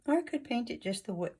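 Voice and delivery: talking through hiccups. Real, not acted. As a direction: caught by a hiccup mid-sentence. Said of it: "I'm hiccuping".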